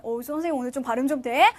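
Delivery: ordinary talk, nothing marked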